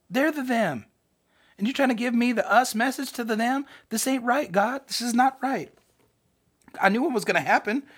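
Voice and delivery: high, protesting mock complaint; angry Jonah. Preacher voicing Jonah arguing with God about the them, mock-angry. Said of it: "How angry was he?".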